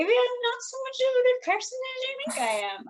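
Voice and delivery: real, tearful high strain; trying not to cry. Real, not acted. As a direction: voice climbing high with emotion. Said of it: "I'm gonna try not to cry".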